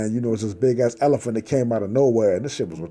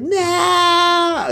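much higher anguished yell; mock scream; 4 dB louder than usual. Victim waking up, crying out in horror. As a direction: cry out in horror.

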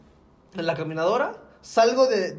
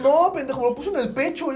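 ordinary talk, nothing marked

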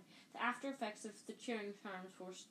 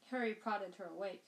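ordinary delivery

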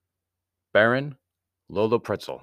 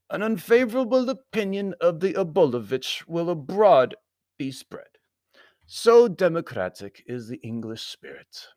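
foreign Baron; 10 semitones higher, 6 dB louder than usual. A narrator's reading. Baron fretting over English opinion, formal voice.

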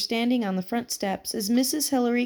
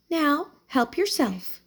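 smiling invitation; 5 semitones higher, slower than usual. A narrator's reading. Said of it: "she said, smiling".

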